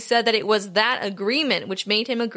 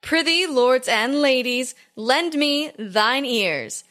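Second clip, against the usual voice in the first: hammy stage actor; slower than usual. Acted. Renaissance fair herald intro.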